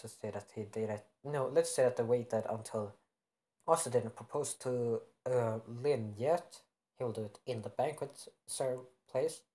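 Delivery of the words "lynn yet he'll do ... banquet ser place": ordinary delivery, nothing marked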